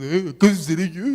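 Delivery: loud, put-on mimicking voice; mocking echo. Jesus answering Judas in the preacher's skit, mocking echo. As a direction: parrot the question back mockingly. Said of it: "Le dice Jesús".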